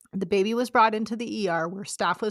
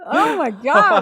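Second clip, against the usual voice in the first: crying, worn out; 9 semitones higher, 12 dB louder, slower than usual. Real, not acted. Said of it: "I can't stop crying".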